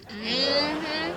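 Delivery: ordinary delivery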